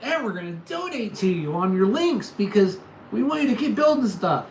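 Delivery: high, loud eager viewer imitation; hyped-up imitated viewer; mock fan voice. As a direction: gush like an excited fan. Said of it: "coming back into the chat and going".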